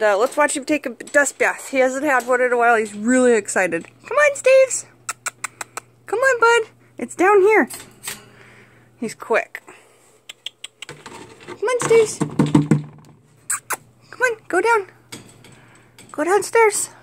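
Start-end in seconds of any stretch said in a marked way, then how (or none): 4.1-7.7 pet coaxing voice. Real, not acted. Coaxing her chinchilla to move. 11.5-16.9 pet coaxing voice. Real, not acted. Coaxing chinchilla into dust bath.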